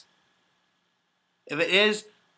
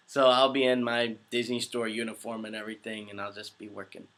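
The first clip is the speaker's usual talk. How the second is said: normal talking voice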